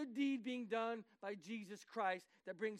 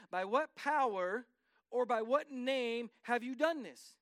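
slowed, louder, each word leaned on; measured accusing question, louder; slow pointed question; 5 dB louder, slower than usual. Retold or imitated. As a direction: interrogate him slowly and pointedly. Leaders questioning Peter.

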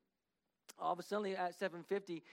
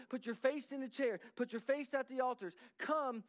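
higher and louder, urgent preaching push; preaching at full force; 6 semitones higher, 4 dB louder than usual. Acted. Pastor urging the congregation to seek God, preaching hard. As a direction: drive the call home to the crowd.

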